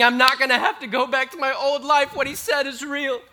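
hopeful excitement. Acted. Mary thinking her old life is over, eager and loud. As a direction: rush it out in relief. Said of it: "all this hope and excitement fills her".

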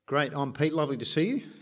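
normal talking voice

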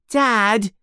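high-pitched call for Dad; child's yell. A narrator's reading. Dudley calls out to his father, high yell. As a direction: yell it high, like a kid telling on someone. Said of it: "yells Dudley".

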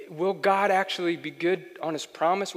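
usual speaking voice

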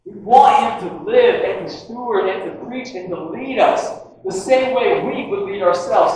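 ordinary talk

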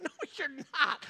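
high, slow laughing "no"; laughing dismissal. Retold or imitated. Youth pastor laughing at teen's insistence, slow and high. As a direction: chuckle through the refusal.

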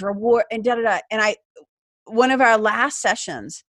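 ordinary talk, nothing marked

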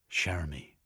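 ordinary delivery, nothing marked

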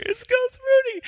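very high, strained emotional squeak; choked-up squeal. Retold or imitated. Watching the movie on the couch, a high choked-up squeal. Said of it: "I'm on the couch just like".

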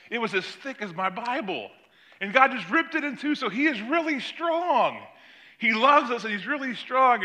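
a first grader amazed at God's strength; excited child. Retold or imitated. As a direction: granddaughter telling grandpa how strong God is. Child's amazed retelling, voiced.

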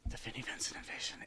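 breathy stage whisper of the band name. Acted. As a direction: whisper it like a guilty secret. Dramatic aside about the band.

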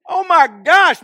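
loud, high burst of delight; real gleeful exclamation; excited shout. Real, not acted. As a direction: cheer like you just scored. Preacher celebrating the verse.

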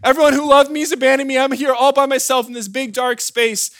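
high, loud, hammed-up wail; mock screaming. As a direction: imitate a baby crying out in panic. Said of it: "they scream out at the top of their lungs".

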